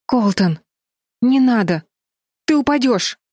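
high, anxious warning rising in pitch; alarmed plea. A narrator's reading. Флис pleads with Колтон on the windowsill, alarmed. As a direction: beg him not to do it.

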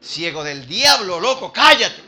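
loud, high, drawn-out telling-off; scolding shout to hush. Acted. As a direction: shout him down. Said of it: "Y lo mandaban".

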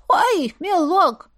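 high, slow startled granny; startled old woman. Acted. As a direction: yelp like a frightened old lady. Испуганная старушка-призрак. (испуганная старушка)

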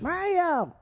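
very high cartoon call; squeaky falsetto calling out; Toad. Co-host calling his partner into the scene, high cartoon voice. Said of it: "That almost sounds like a Toad".

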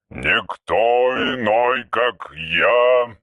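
low, very slow archaic voice; an old machine speaking in verse; ancient robot. Acted. (низкий медленный голос древнего робота)

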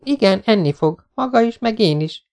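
put-on teasingly formal as a young woman; Jessica's voice. A narrator's reading. Jessica's lines. (játékos, magasabb női hang)

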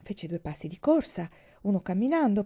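ordinary delivery, nothing marked

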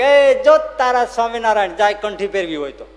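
loud, high shout across the field; calling out. Acted. Villager tells the ploughman his Lord is passing, calling out loudly. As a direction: holler the news out to someone.

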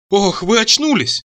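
high, drawn-out plaintive wail; slow, whiny exclamation; tearful cry. A narrator's reading. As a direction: whimper with relief at the waking. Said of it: "вскричал Мурзик плачуще".

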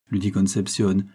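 ordinary delivery, nothing marked

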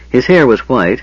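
ordinary delivery

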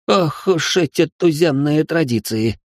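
выше и медленно, ошарашенно; протяни, не веря глазам. A narrator's reading. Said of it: "ошарашенно произнёс Ричард". (Ричард ошарашен туземными традициями, выше и медленно)

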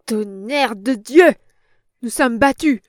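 furious, loud outburst at losing; a big angry roar of defeat; enraged. A narrator's reading. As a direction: bellow it in rage. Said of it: "avec un indicible accent de rage".

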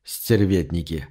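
low, soft, slow lament; rueful. A narrator's reading. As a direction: sigh the word out ruefully. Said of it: "сокрушённо проговорил он". (сокрушённо, тихо и медленно)

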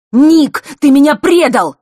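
high, loud, hurt accusation; wounded furious cry; angry outburst. A narrator's reading. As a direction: fling the betrayal at him. Boy accuses friend of betrayal.

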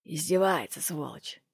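low-volume resentful grumble, dropping away; grumbling. A narrator's reading. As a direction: grumble the curse under your breath. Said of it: "проворчал Лок".

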